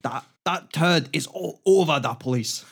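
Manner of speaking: a badly faked Scottish accent; mock Scottish accent, half-hearted. Cohost trying a failed Scottish accent, half-hearted. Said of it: "I can't do a Scottish accent".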